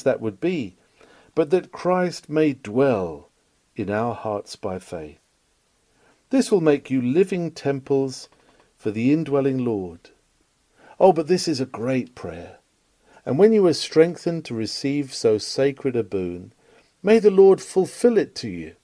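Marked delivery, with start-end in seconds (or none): none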